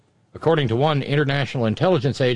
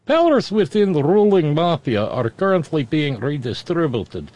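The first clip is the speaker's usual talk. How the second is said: put-on Russian accent. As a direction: read it like a Russian official. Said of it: "I get to use my Russian accent".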